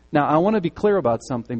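ordinary talk, nothing marked

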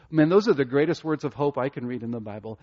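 ordinary talk

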